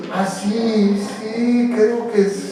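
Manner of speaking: soft, absent-minded reply; distracted child. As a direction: answer quietly while absorbed in a game. Acted absorbed child answering parent. (niño distraído, respuesta suave y ausente)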